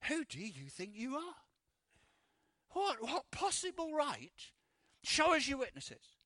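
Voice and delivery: indignant challenge. Retold or imitated. Hearers react immediately to Jesus' claim, indignant challenge. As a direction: demand answers as an outraged objector.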